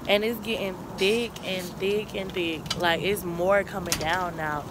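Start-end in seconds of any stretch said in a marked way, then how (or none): none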